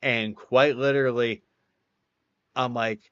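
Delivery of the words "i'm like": usual speaking voice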